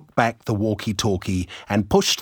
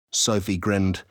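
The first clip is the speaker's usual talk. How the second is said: usual speaking voice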